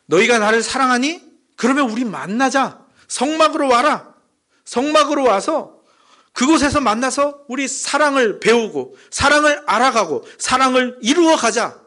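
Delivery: high-pitched, insistent, direct appeal. Acted. God inviting Israel to the tabernacle, direct. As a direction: urge them straight out, pitched high. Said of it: "하나님께서 단도직입적으로".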